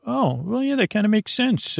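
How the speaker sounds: mock listener voice. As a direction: voice a listener giving in with a lilt. Imagined listener agreeing.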